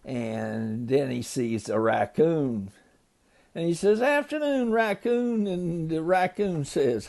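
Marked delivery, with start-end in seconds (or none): 4.0-5.5 high, lightly acted storybook hello; friendly greeting. A narrator's reading. Gator says hello to the raccoon, high greeting. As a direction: start high and ease down on the name.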